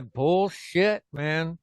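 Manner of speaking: normal talking voice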